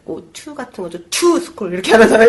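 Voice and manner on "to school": "to" squeezed short before a loud "school"; shortened function word. Shown as a demonstration. Teacher showing contracted words between stresses, "to" clipped short. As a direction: reduce "to", land hard on "school". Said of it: "되게 짧게 축약해서".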